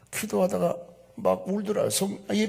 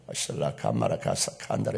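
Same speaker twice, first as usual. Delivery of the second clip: very low, softer run of prayer syllables; imitated tongues; 5 dB quieter than usual. Shown as a demonstration. Preacher shows his congregation tongues prayer, low imitated syllables. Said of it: "이렇게 하고 막 방언이 나오는데".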